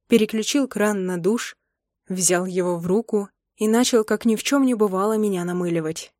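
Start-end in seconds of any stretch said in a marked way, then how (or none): none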